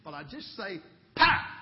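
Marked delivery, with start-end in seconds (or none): 1.2-1.6 sudden loud, high sound-effect shout; explosive pow. Retold or imitated. Dad knocking out son's imaginary bear, explosive shout. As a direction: punch the word out like a comic-book blow.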